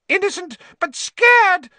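high, loud, squeaky cry; shrill frightened rat-man squeal. A narrator's reading. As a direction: squeal in terror. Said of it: "squealed Pettigrew".

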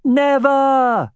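high, long drawn-out call; shouted back. A narrator's reading. Grinning hero calling to his friend through cupped hands, shouted back. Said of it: "shouted back".

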